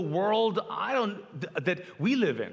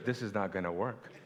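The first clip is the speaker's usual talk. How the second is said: lower, softer words through a laugh; laughing wife quote; 9 semitones lower, 7 dB quieter than usual. Retold or imitated. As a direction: giggle it out quietly. Wife on too-high stool, laughing.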